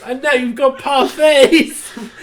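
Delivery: slow, high, loud words broken by laughter; retelling the parfait twist while laughing; laughing disbelief. Retold or imitated. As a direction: drag it out, cracking up. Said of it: "there's like".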